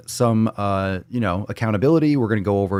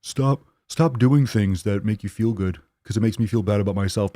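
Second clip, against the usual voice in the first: mock critic. Friends objecting to your weight loss, mock complaint. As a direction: imitate a resentful friend objecting. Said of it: "people in your circle are like".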